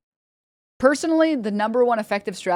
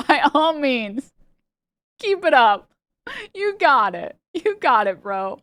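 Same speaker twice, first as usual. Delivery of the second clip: mock encouragement; 6 semitones higher, slower than usual.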